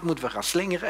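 ordinary delivery

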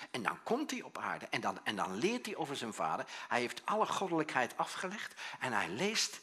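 ordinary delivery

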